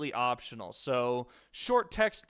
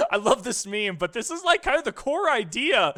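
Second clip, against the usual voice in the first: louder, higher, excited; 9 semitones higher, 9 dB louder than usual. Real, not acted. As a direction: gush over a favorite meme. Excited about meme.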